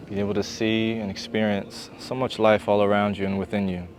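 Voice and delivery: calm, unhurried yoga-teacher voice with long pauses; settled, even meditation delivery; guided meditation. Real, not acted. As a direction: guide them gently into gratitude.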